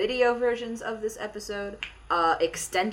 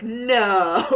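loud mock-shocked no, laughing; retold parental disbelief; 6 dB louder than usual. Retelling parents' reaction to the actress, mock disbelief. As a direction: imitate stunned parents.

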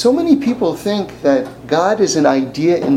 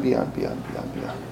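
softer, whispered "beyond"; fading whisper; 3 semitones lower, 12 dB quieter, slower than usual. Real, not acted. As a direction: let it die away. Repetition fades.

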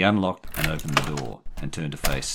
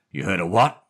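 louder, higher incredulous question; gruff disbelief; 5 semitones higher, 6 dB louder than usual. A narrator's reading. Jerry interrupting the boy's story, gruff disbelief. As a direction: cut in, incredulous.

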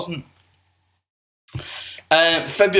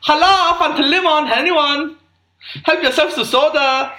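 high, loud camp German; failed German accent; 9 semitones higher, 4 dB louder than usual. Acted. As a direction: offer drinks as an over-eager camp German. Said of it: "I can't do it".

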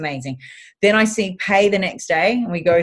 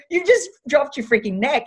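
higher, impatient scolding; exasperated telling-off; 8 semitones higher than usual. Retold or imitated. Physio scolding her patient for cheating the bend, exasperated. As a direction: call out the cheat, fed up.

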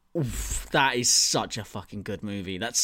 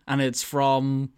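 usual speaking voice

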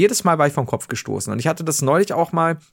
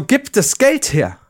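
slow, higher, emphatic demand; retold demand; 7 semitones higher, much slower than usual. Man demanding the money back from the beggar, slow and higher in retold anger. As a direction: spell it out slowly as a threat. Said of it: "dann bin ich sauer geworden".

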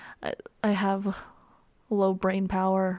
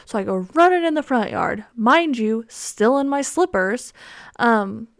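normal talking voice

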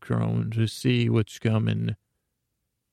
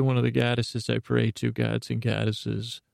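ordinary talk, nothing marked

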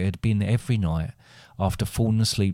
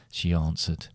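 plain reading voice

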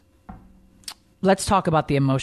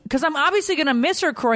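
high, quick exasperated retort; retold indignation; 12 semitones higher, faster than usual. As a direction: snap back at an obtuse husband. Retold indignant reply to husband.

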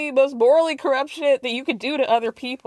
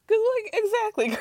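excited agreement; 9 semitones higher than usual. Real, not acted. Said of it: "that's when I cackled".

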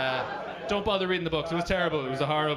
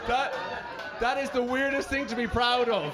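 laughing shout; 4 semitones higher than usual. Real, not acted. Laughing outburst at the Viking remark.

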